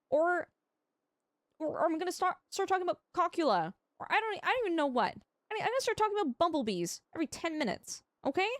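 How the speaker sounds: playful threat. Acted.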